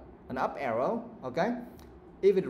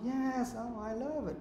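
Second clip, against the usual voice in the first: higher, upbeat rising delivery; raised positive tone; 9 semitones higher, 5 dB quieter than usual. Shown as a demonstration. Teacher showing students how positive sounds, voice raised higher. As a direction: let your pitch go up for good news. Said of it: "you raise your voice a little. You go up".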